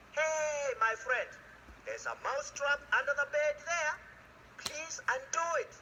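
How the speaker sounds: high, pleading little mouse voice; a squeaky mouse asking nicely. Acted. Mouse begs friends for help.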